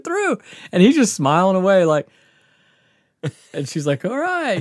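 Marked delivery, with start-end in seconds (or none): 4.0-4.6 high-pitched mock acceptance; doubtful, high "all right"; reluctant mom. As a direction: imitate a mother giving in, voice way up.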